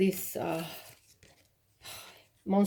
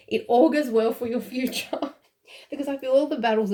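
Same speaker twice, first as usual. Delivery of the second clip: laughing; 5 semitones higher, 4 dB louder than usual. Real, not acted. Laughing through a happy reading.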